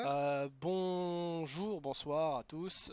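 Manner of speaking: very slow, stretched welcome; slow drawn-out greeting. Acted. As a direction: drag out bonsoir lazily. Restarting the podcast intro.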